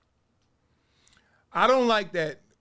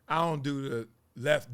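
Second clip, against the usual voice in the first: usual speaking voice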